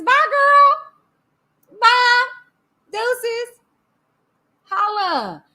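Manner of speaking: high-pitched, drawn-out send-off; sassy dismissal. Real, not acted. Streamer sees off an upset viewer, sassy dismissal. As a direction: wave them off with sass.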